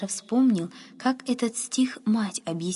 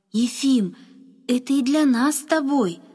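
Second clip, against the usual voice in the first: put-on higher, louder, eager boy; delighted exclamation; 5 semitones higher, 5 dB louder than usual. A narrator's reading. Boy shares his discovery with the groom, exclaiming. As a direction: exclaim it with sudden joy. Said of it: "воскликнул он".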